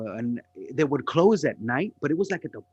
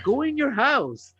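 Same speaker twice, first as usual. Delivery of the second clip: sarcastic cop; 12 semitones higher, 3 dB louder than usual. Retold or imitated. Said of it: "He was like".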